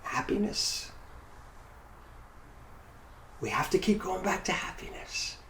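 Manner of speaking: soft, hushed whisper. Real, not acted. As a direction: breathe it like a secret. Hushed key message.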